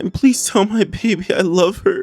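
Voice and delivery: high, pleading, apologetic reading of the texts; voicing a distraught Huda. Narrating Huda's texts to Louis, high and pleading. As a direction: read it like a tearful goodbye. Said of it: "Huda starts us off saying".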